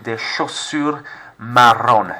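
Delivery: "Des chaussures marron" slowly, no s on chaussures; slow, end chaussures without s; silent S. Shown as a demonstration. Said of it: "Silent S on the end".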